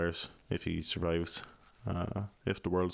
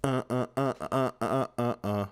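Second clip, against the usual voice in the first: usual speaking voice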